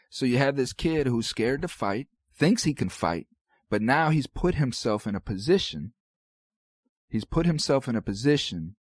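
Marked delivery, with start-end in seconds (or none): none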